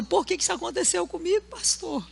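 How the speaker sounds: hammed-up sobbing complaint to his pastor; mock crying. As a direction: ham up the crushed young preacher. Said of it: "desceu envergonhado, triste".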